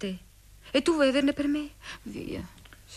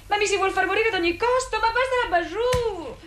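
dramatic complaint; 7 semitones higher, 3 dB louder than usual. Acted. Theatrical complaint about the lights.

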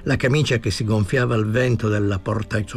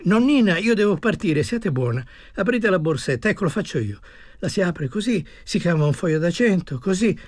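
higher-pitched, wheedling plea; coaxing, urgent man; 7 semitones higher than usual. Acted. Departing man coaxing an old woman to open her purse, urgent. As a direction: coax her along with a lifted voice.

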